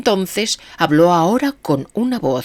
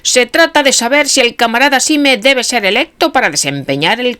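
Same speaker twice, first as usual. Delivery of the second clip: mechanical ritual; 8 semitones higher, 5 dB louder than usual. A narrator's reading. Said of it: "como un reloj de repetición".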